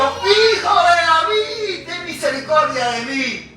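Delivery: high, slow pleading shout; crying out. Retold or imitated. Blind man crying out to Jesus over the crowd, high pleading shout. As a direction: call out over the crowd, begging for mercy. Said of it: "él gritó y dijo".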